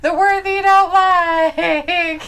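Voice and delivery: high, slow singsong breaking into laughter; exaggerated teasing. Repeating the tease about the C word, exaggerated. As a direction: stretch it out in a mock-wailing tease.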